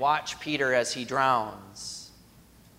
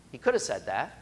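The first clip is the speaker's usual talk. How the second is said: usual speaking voice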